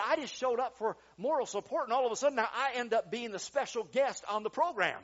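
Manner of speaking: high, loud incredulous protest; retold disbelief. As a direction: act out a man suddenly roped in. Retold bewildered guest's protest.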